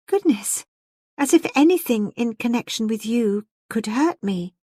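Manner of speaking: put-on high, lofty young lady; serene supremacy. A narrator's reading. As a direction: brush it off with serene superiority. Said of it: "she said with serene supremacy".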